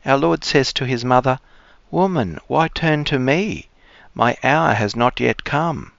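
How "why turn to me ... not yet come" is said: ordinary talk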